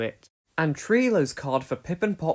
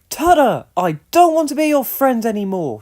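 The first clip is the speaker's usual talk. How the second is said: loud, high angry yell; angry shout; 7 semitones higher, 11 dB louder than usual. A narrator's reading. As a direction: shout it right in his face. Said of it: "Ojo was angry".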